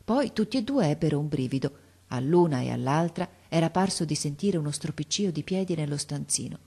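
ordinary delivery, nothing marked

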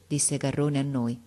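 ordinary delivery

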